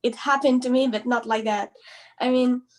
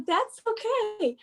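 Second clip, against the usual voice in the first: reassuring mom; 10 semitones higher, slower than usual. Retold or imitated. Soothing upset mom.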